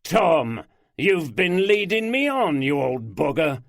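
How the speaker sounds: loud high indignant laugh-out; mock outrage. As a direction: catch him out, amused. Oldroyd realises the joke.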